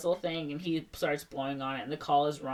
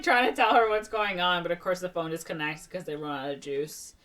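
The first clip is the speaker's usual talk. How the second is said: ordinary talk, nothing marked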